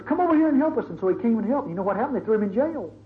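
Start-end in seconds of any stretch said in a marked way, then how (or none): 0.0-0.8 Macedonian calling. Retold or imitated. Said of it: "the guy from Macedonia says".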